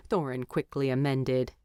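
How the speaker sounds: usual speaking voice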